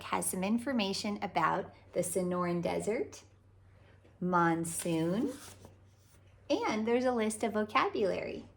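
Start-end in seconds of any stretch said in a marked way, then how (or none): none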